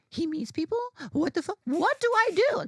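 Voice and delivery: high, rising fan panic; flustered excitement. Retold or imitated. Fan learning the author meets people, flustered excitement. Acts out her flustered reaction.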